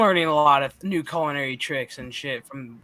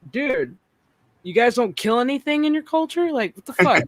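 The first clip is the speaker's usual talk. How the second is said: raised high and loud in disbelief; stunned, mocking outrage at their culture; pitched-up disbelief, half yelling; incredulous rant; 7 semitones higher, 5 dB louder than usual. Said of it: "I'm like".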